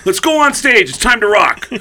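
high, loud brush-off, easing as it goes; pissed off. Retold or imitated. Drummer to bandmates teasing him, irritated and loud. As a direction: burst out, then hurry them along. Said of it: "He's pissed 'cause he thinks everyone".

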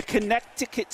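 "Connecticut" with the middle C sounded, con-NECK-ti-cut; spelling pronunciation. Shown as a demonstration. Sports host jokes on the spelling, "Connecticut" with a sounded middle C. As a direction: say "Connecticut" as spelled, hard K before the T. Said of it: "pronounce it the way it's spelled".

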